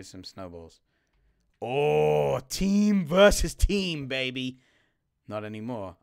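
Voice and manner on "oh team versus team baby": loud, high, slow hype call; excited. Real, not acted. Starting a snowball fight, loud drawn-out hype. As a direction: stretch it out like an announcer.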